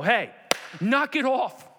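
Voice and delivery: loud, high call to stop; sharp rebuke. Acted. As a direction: call someone out forcefully. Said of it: "walk up to us and go".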